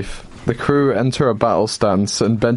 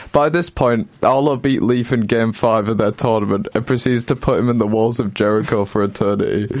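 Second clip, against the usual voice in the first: ordinary delivery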